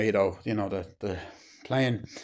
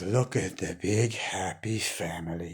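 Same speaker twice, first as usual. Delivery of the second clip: low, soft, slow sneer; mock the family under your breath; sneering villain; 4 semitones lower, 6 dB quieter, slower than usual. Robotnik sneering at the family in a low, slow voice. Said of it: "then he's just like".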